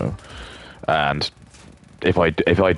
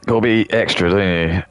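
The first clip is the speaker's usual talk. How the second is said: normal talking voice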